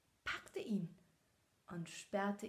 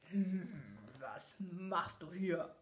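angry growl; 2 semitones lower, much slower than usual. A narrator's reading. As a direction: growl the question at the intruder. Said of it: "rief es wütend".

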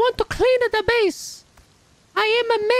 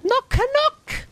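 put-on lightly done Italian nonna accent, broken English; an old Italian cleaning lady pleading at the door in a mild Italian accent; Nonna Maria Italian accent. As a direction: play a little old Italian grandma who wants to clean, thick Italian accent. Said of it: "I can naturally do a good Italian accent".

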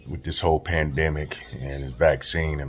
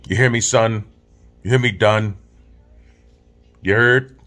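loud, higher-pitched Brooklyn street talk; Brooklyn accent; 7 semitones higher, 8 dB louder than usual. Shown as a demonstration. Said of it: "That's my Brooklyn accent".

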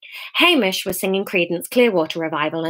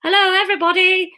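shouting; 11 semitones higher than usual. Acted. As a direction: call out over a noisy room. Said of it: "resorted to shouting".

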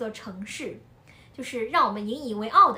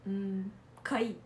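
low, slow, lukewarm "嗯，可以"; a noncommittal mumble, lower and softer; unimpressed husband; 4 semitones lower, 8 dB quieter, slower than usual. Retold or imitated. Husband shrugs off the film, low and slow. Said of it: "然后我老公说".

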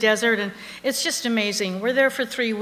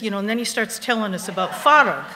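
normal talking voice

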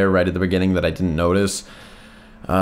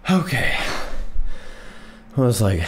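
out of breath; 3 semitones higher than usual. Real, not acted. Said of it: "Oh, I feel really lightheaded".

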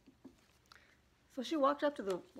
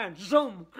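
loud cartoon whoosh word; cartoon zoom; 10 dB louder than usual. Friend fleeing the car like a cartoon, loud zoom. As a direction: shoot it out like a cartoon getaway. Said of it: "like a cartoon character".

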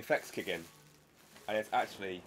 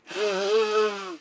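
sharp gasp; 9 semitones higher, 9 dB louder than usual. Real, not acted. Sudden alarm at breakage.

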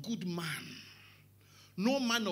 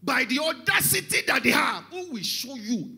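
loud, raised sermon voice; full-force preaching; 8 semitones higher, 14 dB louder, slower than usual. Real, not acted. Preacher decrying their policies and audacity, full-force preaching. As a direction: hammer it out from the pulpit.

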